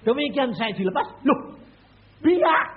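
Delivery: rising to a loud high shout; shocked shout. Acted. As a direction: build to a shocked cry. Realizes it's Irian. (berteriak kaget makin tinggi)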